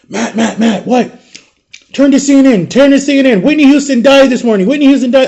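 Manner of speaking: friend calling out high and urgent. Retold or imitated. Friend phoning with shocking news, urgent and high. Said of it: "I remember my friend Jamie".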